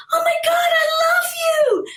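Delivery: imitated fan squeal, very high-pitched; screaming fan. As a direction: shriek your adoration in a put-on high voice. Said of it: "screaming at the top of her lungs".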